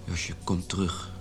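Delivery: slow, whispered plea to come back; a near-whispered, slow appeal; hushed plea. Acted. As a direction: breathe the words, begging softly. Pleading with ex before divorce.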